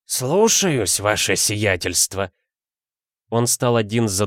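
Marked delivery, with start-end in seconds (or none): 0.0-2.3 put-on dignified old butler. A narrator's reading. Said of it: "с достоинством произнёс".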